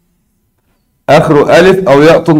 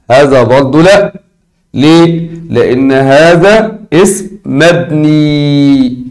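ordinary talk, nothing marked

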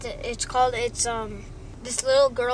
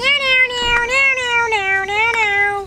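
high loud meows; nerdy bunny meowing over and over; cat meowing; 12 semitones higher, 9 dB louder, slower than usual. Retold or imitated. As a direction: meow like a cartoon cat. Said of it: "he goes like this".

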